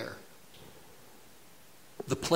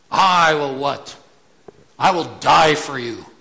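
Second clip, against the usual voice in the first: louder, bold vow of Peter; emphatic boast; 9 dB louder than usual. Retold or imitated. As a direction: declare it boldly like Peter. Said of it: "Peter made emphatic statements".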